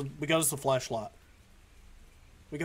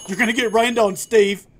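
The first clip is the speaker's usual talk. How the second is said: loud, high shout after someone; calling out a warning; 8 semitones higher, 13 dB louder than usual. Pretend. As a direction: holler it to someone walking away. Calling after Steve in the rain.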